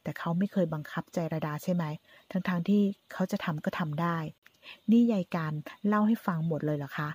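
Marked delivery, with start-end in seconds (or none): none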